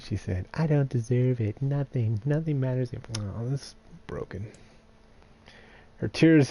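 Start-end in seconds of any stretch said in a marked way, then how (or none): 0.5-2.9 a lightly played girl pushing away comfort; lightly voiced girl Kaine.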